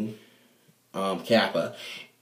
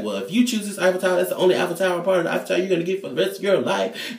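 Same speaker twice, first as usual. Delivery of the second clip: fast retort; 8 semitones higher, much faster than usual. Retold or imitated. Retelling own fast threat.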